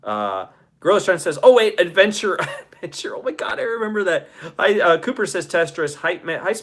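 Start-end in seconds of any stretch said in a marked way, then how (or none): none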